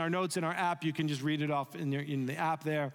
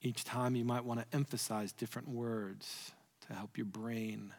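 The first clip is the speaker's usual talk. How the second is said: soft prayerful guidance; 6 semitones lower, 7 dB quieter than usual. Real, not acted. Guiding quiet reflective prayer time.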